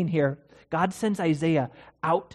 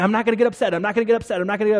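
tense mantra; 4 semitones higher, 6 dB louder, much faster than usual. Retold or imitated. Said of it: "You think".

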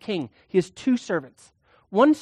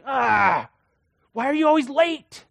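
exasperated; 6 semitones higher than usual. Retold or imitated. Wife scolding late husband.

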